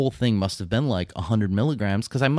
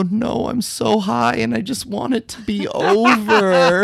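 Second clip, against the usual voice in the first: much higher and slow, dazed whine; slow, whiny stoned voice; 12 semitones higher, 5 dB louder, slower than usual. Retold or imitated. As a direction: moan it like you're way too high. Stoned self, slow and high.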